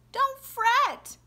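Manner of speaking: high-pitched little mouse voice; high storybook mouse squeak; squeaky mouse. A narrator's reading. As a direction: squeak it out to comfort a friend. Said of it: "and the mouse squeaks".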